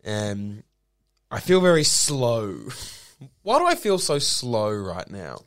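ordinary talk, nothing marked

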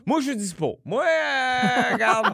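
voix aiguë et traînante d'un ami; message vocal de chum. Retold or imitated. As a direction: imiter un ami qui laisse un message vide. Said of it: "puis que ton message, c'est". (voix aiguë de chum au répondeur)

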